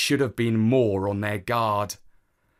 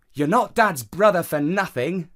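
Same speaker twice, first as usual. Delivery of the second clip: put-on child character; 6 semitones higher, 4 dB louder than usual. A narrator's reading. Nephew teasing uncle in story.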